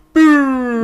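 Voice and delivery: a sudden, high, loud jeering boo. Retold or imitated. Opposition benches jeering a speech, loud boo. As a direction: boo the speaker down hard. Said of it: "everyone else on the other side goes".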